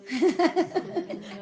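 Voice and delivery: high burst of helpless laughter; real hearty laugh. Real, not acted. Teacher reacting to students' food wishes in paradise, hearty laugh.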